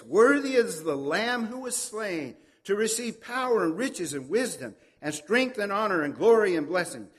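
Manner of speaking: loud, proclaiming the Lamb worthy. Acted. Angels around the throne praising the Lamb, loud proclamation. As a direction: call it out like a great crowd of angels. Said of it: "they say with a loud voice".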